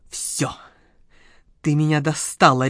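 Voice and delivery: low, fed-up growl; understated bark at the end of patience; angry bark. A narrator's reading. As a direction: snap with finality, kept low. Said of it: "рявкнула Володарская".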